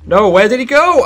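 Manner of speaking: high, loud cry of disbelief; exasperated high wail; dismayed yell. Real, not acted. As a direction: shout in frustration at losing the target. Loses target mid-fight.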